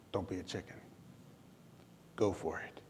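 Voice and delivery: a quiet voice inside egging him on; the little voice inside urging him on, softly; inner voice. Retold or imitated. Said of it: "this little voice inside of me".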